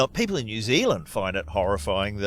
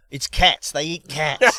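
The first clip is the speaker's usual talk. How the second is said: indignant caller, raised and louder; higher, louder mock outrage; mock furious; 5 semitones higher, 5 dB louder, slower than usual. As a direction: imitate an outraged complainer correcting the record. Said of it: "was furious about that call".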